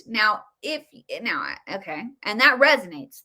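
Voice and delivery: normal talking voice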